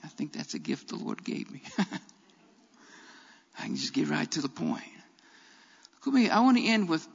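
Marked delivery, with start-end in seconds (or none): 0.0-4.8 chuckling aside. Real, not acted. Self-mocking aside to congregation.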